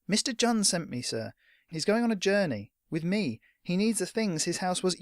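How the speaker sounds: lightly voiced anxious boy; insistent boy. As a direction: plead your case politely. Trip insists.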